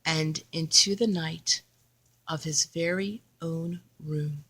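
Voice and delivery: put-on expressive read-aloud for a child; story narration. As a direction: read it like a bedtime picture book. Reading picture book to child.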